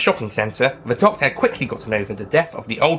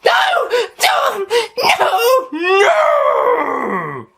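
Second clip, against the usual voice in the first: high, loud sobbing wail; anguished crying; 5 dB louder, much slower than usual. Acted. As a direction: grief breaks out as a cry. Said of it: "Tom's parents cried".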